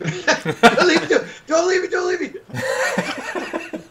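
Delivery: high, drawn-out mock plea with laughter; play-pleading, very high and slow. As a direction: beg in a squeaky, hammed-up wail.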